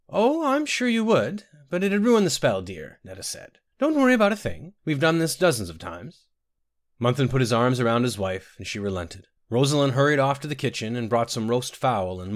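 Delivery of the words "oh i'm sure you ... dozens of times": high, sweetly indulgent soothing; motherly reassurance. A narrator's reading. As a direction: soothe her in a high, fussing voice. Netta soothes the worried mother.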